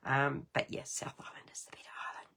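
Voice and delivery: conspiratorial whisper; whispered aside. Real, not acted. Crafter telling viewers South Island is better, whispered. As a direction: whisper it like a secret. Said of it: "whispered aside about islands".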